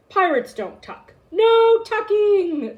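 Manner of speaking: slow, loud, high refusal; crew crying out. A narrator's reading. Pirates loudly refuse to tuck the boy in. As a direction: bellow it like offended pirates. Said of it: "the crew cried".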